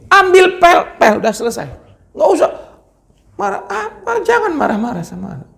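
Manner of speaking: high-pitched, impatient command; just tell him to grab the mop; preacher telling fathers to mop up, not shout; exasperated instruction. Acted. Preacher gives a blunt instruction.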